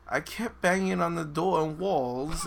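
put-on slow, reluctant English accent; attempted English accent. Reading Gladys's story after friends insist, attempted English accent. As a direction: read it in an amateur English accent. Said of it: "in English accent".

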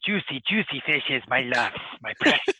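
hint of a creepy creature voice; lightly voiced Gollum-like creature; Smeagol voice. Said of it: "about Smeagol".

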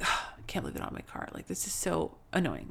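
fast, whispered grumble under the breath; frustrated whisper. Retold or imitated. Mother stuck in a rental car, whispering fast in frustration. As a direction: mutter the complaint to yourself, quickly. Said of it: "getting frustrated and being like".

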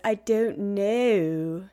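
slow, drawn-out Nicolas Cage imitation in an unplaceable accent; mock his unplaceable, British-leaning accent, dragged out slowly; Nicolas Cage's odd accent. Podcaster mocking the actor's line reading, imitated odd accent. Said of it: "every single time when he says".

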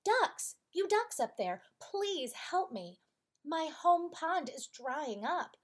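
high, loud call up to the sky; a small turtle calling up to flying ducks for help; calling up loudly. A narrator's reading. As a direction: shout up to the ducks overhead, pleading. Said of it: "the turtle called up to them".

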